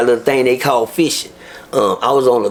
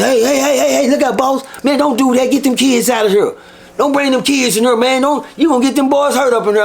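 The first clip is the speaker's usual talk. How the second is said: much higher, urgent hey-hey warning; old inmate's urgent warning; 9 semitones higher than usual. Retold or imitated. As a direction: shoo the kids out before trouble. Old inmate warns at the door.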